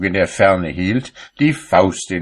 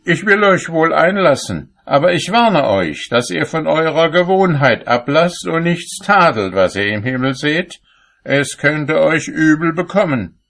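warning; 9 semitones higher than usual. A narrator's reading. Saint Peter warns the cobbler.

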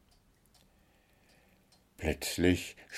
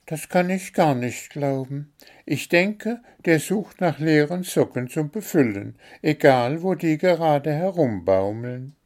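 higher bird voice, insistent; stubborn little bird; 7 semitones higher than usual. A narrator's reading. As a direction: bird refusing to be convinced.

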